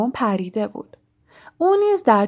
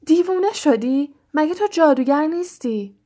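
higher, exasperated cry; shout; 6 semitones higher than usual. A narrator's reading. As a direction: yell at her in disbelief. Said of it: "رون فریاد زد".

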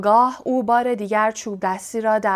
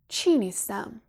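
a touch of irritation, slightly softer; bad-tempered; 3 dB quieter than usual. A narrator's reading. Riddle to Harry, challenging his denial, mildly irritated. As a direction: snap the question back, mildly annoyed. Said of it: "ریدل با بدخلقی گفت".

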